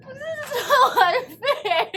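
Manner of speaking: slow, tearful laugh; laughing through tears. Real, not acted. Upset viewer laughing through tears at the movie.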